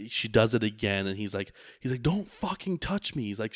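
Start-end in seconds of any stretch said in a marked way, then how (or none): 2.0-3.2 higher, mock-furious snap at her; imitating him lashing out, pitched up; a retold angry outburst, not shouted; retold angry demand. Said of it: "pretty aggressive".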